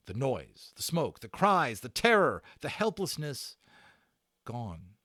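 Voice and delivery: rising list dropping to a hush; dramatic list. A narrator's reading. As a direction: build to the peak and cut off. Climax of battle ending.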